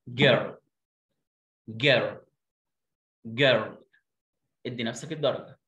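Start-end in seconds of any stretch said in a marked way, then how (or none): 0.1-3.8 جيرل with a hard g; model word. Shown as a demonstration. Teacher modeling جيرل for the class. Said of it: "اسمها إيه؟".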